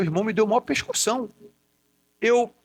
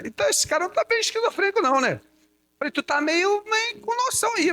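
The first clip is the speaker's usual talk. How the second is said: high, mock-astonished teasing; mock amazement; 11 semitones higher than usual. [name] teases his brother with high mock amazement. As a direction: lift it up in jokey disbelief.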